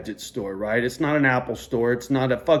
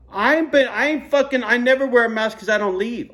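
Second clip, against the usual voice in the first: worked-up rant; 8 semitones higher, 4 dB louder than usual. Real, not acted. Ranting about the mask rule.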